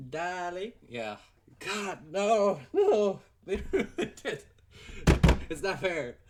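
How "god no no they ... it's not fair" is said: laughing rant. Real, not acted. Ranting about a ruined show.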